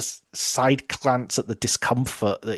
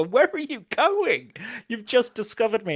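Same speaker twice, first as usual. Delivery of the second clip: high-pitched incredulous protest, played for comedy; mock indignant panic; 10 semitones higher than usual. Captive to the rescuer walking off, hammed-up indignant panic. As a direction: imitate a captive squeaking in disbelief.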